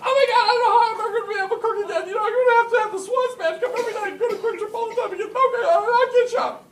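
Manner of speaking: high, loud, rapid imitation of a hysterical wife; hammed-up meltdown, shrieked at top pitch; flipping out. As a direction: race through it in mock hysterics. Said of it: "flips out. She goes".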